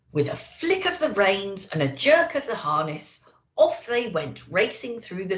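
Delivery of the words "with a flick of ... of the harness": usual speaking voice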